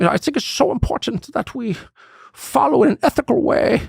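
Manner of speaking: high, put-on German accent, pompous and preachy; Klaus Schwab German accent. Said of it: "in his way, in his accent".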